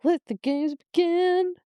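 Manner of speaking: slow, high dramatic announcer; theatrical slow proclamation; mock announcer. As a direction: boom it out like the games host. Announcing Hunger Games line.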